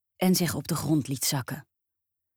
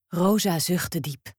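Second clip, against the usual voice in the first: plain reading voice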